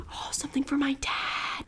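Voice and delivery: whispering. Real, not acted. Secret gift for dad.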